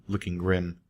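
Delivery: usual speaking voice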